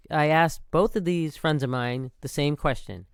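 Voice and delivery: normal talking voice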